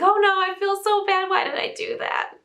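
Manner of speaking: hammed-up regret in a high, pleading voice; mock guilty wail. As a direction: wail apologetically, half in play. Acting out guilt after yelling.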